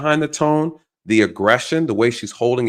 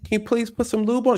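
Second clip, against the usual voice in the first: mock pleading victim; 9 semitones higher, 4 dB quieter, faster than usual. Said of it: "And you sitting back".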